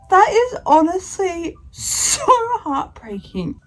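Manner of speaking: slow, high, choked with tears; words catching on a sob; crying. Real, not acted. Vlogger overcome by the story, choked crying.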